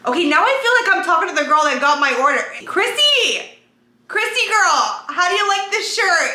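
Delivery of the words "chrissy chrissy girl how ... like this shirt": high, bright call across the room; calling out excitedly. Acted. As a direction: call her name like a friend far off. Calling to the girl with her order.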